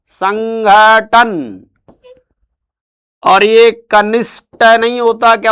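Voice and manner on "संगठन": संगठन said very slowly with clear ठ; slow careful sounding out. Shown as a demonstration. Teacher sounding out संगठन slowly for the class. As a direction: drag संगठन out syllable by syllable. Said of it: "इसमें घे आएगा".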